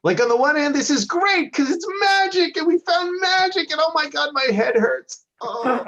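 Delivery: high-pitched giddy, dazed chatter; thrilled about magic, then wince at the pain; high excited rambling. Acted.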